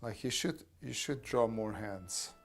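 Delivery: normal talking voice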